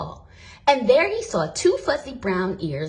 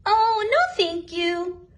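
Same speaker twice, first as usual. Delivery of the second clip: put-on much higher, eager girl; bright child's voice, much higher; little girl; 8 semitones higher than usual. A narrator's reading. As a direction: hug the bear and refuse the box. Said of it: "Lisa answered".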